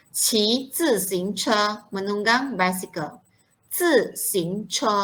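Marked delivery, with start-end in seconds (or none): 0.1-1.5 "Qi zi xing che" drawn out syllable by syllable; slow model pronunciation. Shown as a demonstration. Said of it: "very good pronunciation". 3.7-5.0 "Zi xing che" stretched slowly, each tone clear; slow model pronunciation. Shown as a demonstration. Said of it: "ada yang tak reti sebut dengan betul".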